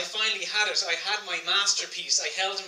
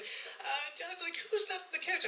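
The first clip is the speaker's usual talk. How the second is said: put-on teen self; 11 semitones higher, slower than usual. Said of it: "I'd say".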